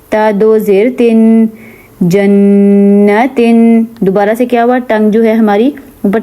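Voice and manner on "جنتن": جنتن drawn out with a nasal ن; slow ghunna. Shown as a demonstration. Teacher reads جنتن with ghunna. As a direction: hold the ن of جنتن in the nose. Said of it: "اسے غنہ کہتے ہیں".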